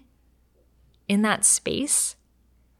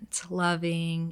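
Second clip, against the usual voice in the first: ordinary delivery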